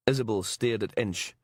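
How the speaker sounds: usual speaking voice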